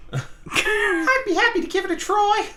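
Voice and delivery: very high, slow falsetto; put-on squeaky, obliging voice; eager cartoon underling. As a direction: squeak it out like a keen little helper. Voices meek hero answering colonel.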